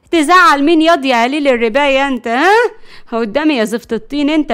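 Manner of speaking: loud scolding retort; bossy, cross rebuke; indignant threat. Acted. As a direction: put the cheeky boy in his place. Grandmother grabs and scolds the boy.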